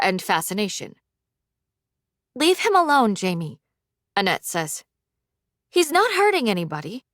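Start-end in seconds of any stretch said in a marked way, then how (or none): 2.3-3.6 higher voice, protesting on behalf of someone; high, urgent interjection; high-pitched protest. A narrator's reading. Annette pleads with the bully. 5.7-7.0 high, pleading defence of someone; higher, protesting tone; high-pitched protest. A narrator's reading. Annette defends him to the bully.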